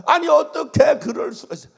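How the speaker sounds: a wailing outcry of disbelief; sobbing protest. Retold or imitated. Deaconess wailing to the pastor on the phone, high and loud. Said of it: "막 그러면서 통곡을 하고 울어".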